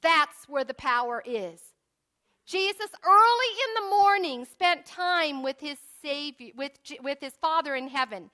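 loud, high revival preaching; fervent preaching. Acted. Preacher exhorts brothers and sisters, loud fervent preaching. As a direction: hammer home the weapons of faith.

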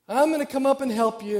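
loud, high holler to someone upstairs; acted yell upstairs. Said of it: "yells up the stairs".

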